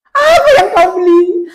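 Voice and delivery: high, loud barked question; angry demand. Acted. Husband demanding what wife bought, loud high bark. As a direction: demand an answer like a fed-up husband.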